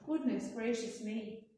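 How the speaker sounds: plain reading voice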